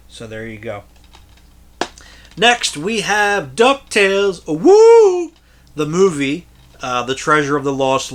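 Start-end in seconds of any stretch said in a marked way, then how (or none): 2.4-5.3 slow, higher build to a loud high woo; excited announcement. Real, not acted. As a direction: hype it up like a cheer. Announcing a favorite cartoon.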